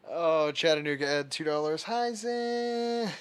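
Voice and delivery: very loud, high squealing greeting; excited thanks. Real, not acted. Streamer thanking donors Ed and Zen on stream, loud squealing delight.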